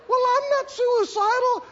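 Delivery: very high, protesting denial; indignant denial. Acted. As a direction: deny it hotly, voice shooting up. Acted quarrel, denial.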